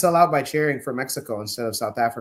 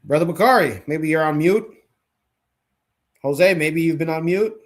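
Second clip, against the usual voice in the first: calling out; 3 semitones higher, 6 dB louder than usual. Real, not acted. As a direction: call out to a muted guest. Host calling to guests on mute.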